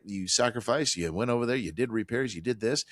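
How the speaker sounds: normal talking voice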